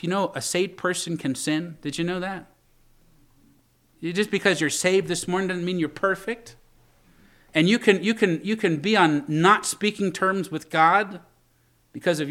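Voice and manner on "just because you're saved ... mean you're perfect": ordinary talk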